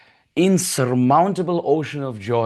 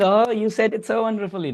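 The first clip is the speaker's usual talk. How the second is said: much higher, cooing admiration; gushing praise; 8 semitones higher, faster than usual. Acted. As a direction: coo over the clever baby. Mothers praise baby's word.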